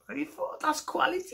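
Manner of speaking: very high, garbled mock Scouse; bad Scouse accent. Comic showing how awful it is, bad Scouse accent. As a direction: squeal out an awful Scouse impression. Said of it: "everyone thinks they can do Scouse".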